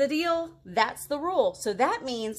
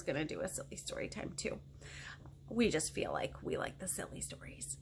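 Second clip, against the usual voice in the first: whisper; 9 semitones lower, 11 dB quieter than usual. Real, not acted. Aside to audience, hushed.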